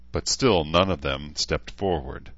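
usual speaking voice

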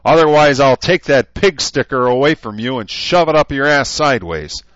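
loud, high drill-sergeant shouting; furious shout. A narrator's reading. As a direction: dress down the guards at full volume. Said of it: "he shouted".

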